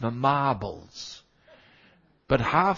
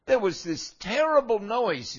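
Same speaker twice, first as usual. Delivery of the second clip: retelling a shock; 9 semitones higher than usual. Retold or imitated.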